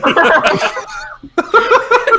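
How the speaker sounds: bursting out laughing; real laugh. Real, not acted. Laughs at own joke.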